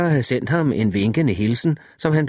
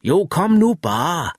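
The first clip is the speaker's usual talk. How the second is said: slow, raised call, higher pitched; shouted back; 5 semitones higher, slower than usual. A narrator's reading. Wireman calls back from his chair, slow and raised. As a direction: coax him over, calling slowly across the sand. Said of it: "råbte han tilbage".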